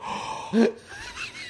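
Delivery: sharp, high imitated gasp; mock shocked gasp. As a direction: gasp as if jaw-dropped with shock. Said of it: "the shocked, you know, jaw dropping".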